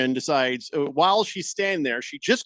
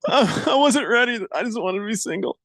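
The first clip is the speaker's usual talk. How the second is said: higher, half-laughing fake sob; mock crying groom; 5 semitones higher than usual. Joking about the crying boyfriend, a hammed-up teary groom. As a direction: whimper like a trapped fiancé. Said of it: "He's like".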